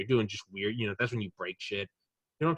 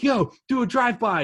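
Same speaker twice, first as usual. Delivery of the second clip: high, loud party yell; whooping alien frat boy; rowdy shout; 12 semitones higher, 7 dB louder than usual. Retold or imitated. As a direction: yell it like a hammered alien joyrider.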